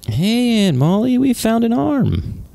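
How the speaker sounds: high, drawn-out call to an aunt; high-pitched mock-excited hail. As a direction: sing it out high and long. Game character calls out to aunt.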